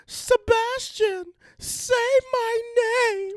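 high, slow, crying plea. Retold or imitated. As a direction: wail it out, high and drawn. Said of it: "when the girl was like".

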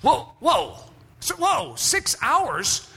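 high, startled whoa; a touch of alarmed disbelief; mock shock. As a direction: recoil at the number. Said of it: "And I said this".

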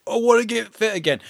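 angry gym nut. Acted. Immersive actor showing his old role, mildly angry gym nut. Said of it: "so I'm angry".

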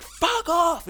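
very high, hammed-up yell; mock furious outburst. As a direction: imitate a contestant losing it as she storms off. Acting out contestant storming off stage.

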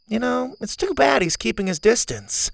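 a hint of a spunky girl; Zeta girl voice.

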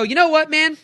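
high and loud, bursting out; excited call-out. Retold or imitated. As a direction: call it out big to grab him. Finally offering the driver money.

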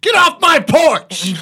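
high, slow, loud yell; shouting at trespasser. Pretend. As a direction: bellow at intruders from the porch. Frog at [name]'s house.

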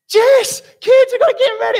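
high, loud panicked call to the family; frantic call. Retold or imitated. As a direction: yell for everyone to hurry up.

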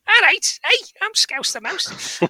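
high-pitched cartoon mouse voice; Scouse the Mouse. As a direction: squeak it out like a children's story character. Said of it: "children's book called Scouse the Mouse".

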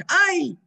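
imitated high yelp from a boy; yelled "ay". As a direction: cry out at the cold plunge, loud and high. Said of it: "הבן צעק". (צעקת "איי" של ילד במים קרים)

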